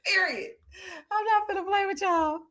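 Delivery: high, breaking into laughter; laughing through words. Real, not acted. Teasing viewers while still laughing. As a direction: talk while still giggling.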